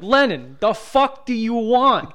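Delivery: mild mock New Jersey mobster yell; mob New Jersey guy. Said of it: "Like, screaming at her".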